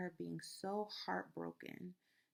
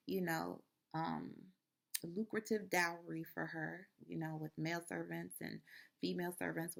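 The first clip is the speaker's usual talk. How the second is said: normal talking voice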